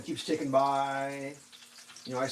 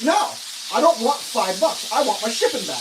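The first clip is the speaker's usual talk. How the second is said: higher and louder, building exasperation; retold indignant protest; 6 semitones higher, 7 dB louder than usual. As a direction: push back hard on the offer. Arguing with support agent.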